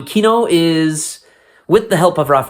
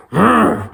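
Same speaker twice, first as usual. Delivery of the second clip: short playful growl, a bit higher; mock growl; 8 semitones higher than usual. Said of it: "They go".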